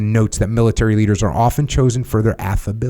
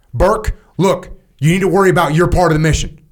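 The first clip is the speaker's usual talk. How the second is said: stern direct command; 8 semitones higher than usual. Shown as a demonstration. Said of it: "raise your voice, and speak in very direct tones".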